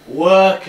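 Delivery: "Work" drawn out and loud; emphasis on WORK. Shown as a demonstration. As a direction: lean hard on "Work". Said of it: "a little bit more emphasis on work".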